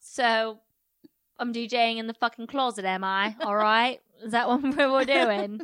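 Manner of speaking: loud, put-out disbelief, laughing at the end; mock indignant. Podcaster playing James told he's DJing in a closet, mock indignant. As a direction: demand answers, hammed-up and loud.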